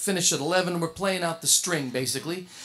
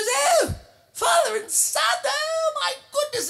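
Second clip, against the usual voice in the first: put-on gushing old lady. [name]'s mother delighted by father and son, high gushing squeal. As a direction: squeal it like a thrilled elderly mother. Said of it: "and she goes".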